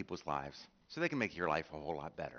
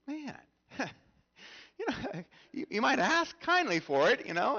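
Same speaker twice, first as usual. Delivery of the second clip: laughing complaint; 8 dB louder, slower than usual. Retold or imitated. Mocking their own grumbling.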